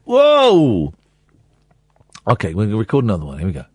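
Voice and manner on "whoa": loud startled whoa; surprised whoa. Real, not acted. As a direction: react in surprise, loudly. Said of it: "Do one that's slightly less sarcastic".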